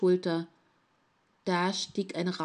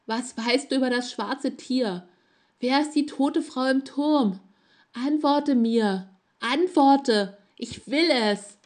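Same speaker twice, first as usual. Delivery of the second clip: steigert sich zum lauten, fordernden Schreien; immer aufdringlicher; 5 semitones higher, 6 dB louder than usual. A narrator's reading. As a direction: erst drängend, dann brüllend fordern. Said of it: "wurde dabei aufdringlicher". (Fremder bedrängt das Mädchen, bis zum Schreien gesteigert)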